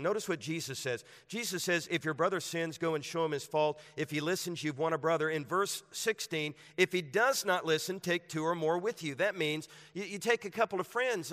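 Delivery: rousing pulpit delivery; preaching at full force. Acted. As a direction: preach it out to the whole room. Preacher ramps up the sermon.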